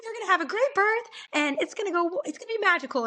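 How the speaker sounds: high, bubbly cheer; imitating a chirpy nurse; peppy nurse. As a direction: pump her up like an eager nurse. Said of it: "She was real peppy".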